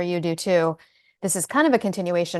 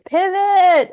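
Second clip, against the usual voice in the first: loud high Friends shout; shouting Pivot; 13 semitones higher, 9 dB louder than usual. Retold or imitated. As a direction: yell it like Ross.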